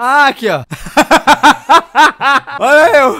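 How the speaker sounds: loud laughing reveal. Real, not acted. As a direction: burst out laughing at the photo. Laughs at old photo.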